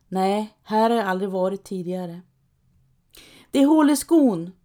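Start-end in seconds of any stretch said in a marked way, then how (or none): 3.5-4.5 high, loud call. A narrator's reading. Trapp calling to the others, loud and high. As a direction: shout it out, pointing at the shoe. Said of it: "ropar Trapp med säcken".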